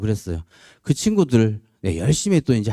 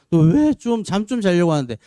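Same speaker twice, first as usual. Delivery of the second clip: higher, half-mock irritated yell; grumpy teen demand; 7 semitones higher than usual. Son wakes his dad at dawn, mock cranky demand. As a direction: mimic the son's sulky morning outburst. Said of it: "막 승질 내고요".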